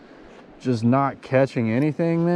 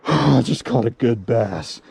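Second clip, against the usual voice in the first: ordinary talk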